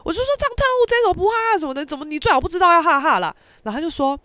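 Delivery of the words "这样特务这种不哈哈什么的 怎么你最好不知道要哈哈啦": high, loud mock outrage; retold accusation. Arguing with a friend about the song, indignant. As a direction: accuse your friend in disbelief, pitched up.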